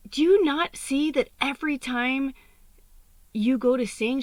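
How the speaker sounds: retold exasperated rant, high and loud; going off on someone. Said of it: "kinda went off on me".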